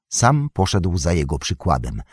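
plain reading voice

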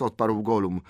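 plain reading voice

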